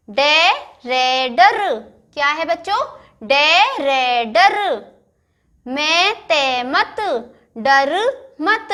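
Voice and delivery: गे जे then गज, each syllable drawn out slowly; slow syllable reading. Shown as a demonstration. Teacher reading गज तक चल syllable by syllable. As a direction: blend letters into words very slowly. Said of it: "next line देखो".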